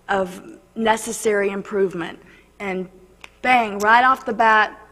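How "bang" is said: ordinary talk, nothing marked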